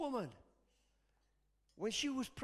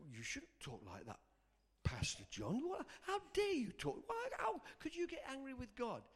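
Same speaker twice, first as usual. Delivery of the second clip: scandalised objector; 6 semitones higher, 8 dB quieter than usual. Acted. Voices a scandalised church member.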